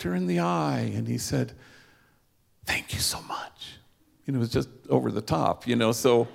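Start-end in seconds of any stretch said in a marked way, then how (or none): 2.6-3.8 soft whispered thank you; hushed, grateful whisper. Retold or imitated. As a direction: breathe it out in a whisper. Grateful thanks retold to woman.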